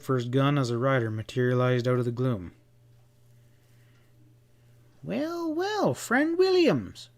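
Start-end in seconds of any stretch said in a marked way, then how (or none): 5.0-7.1 very high, slow upper-class drawl; a high, lazy English drawl of greeting; high-pitched English drawl. A narrator's reading. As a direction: draw it out like a languid English dandy. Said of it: "the Englishman's high-pitched drawl".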